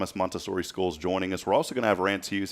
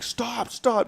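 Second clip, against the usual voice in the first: imitating screaming. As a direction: re-enact your own panicked yelling in a high voice. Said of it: "screaming and yelling".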